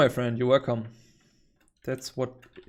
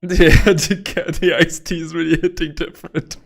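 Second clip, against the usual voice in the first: excited; 4 semitones higher, 9 dB louder than usual. Real, not acted. Caffeine-fuelled laughing burst.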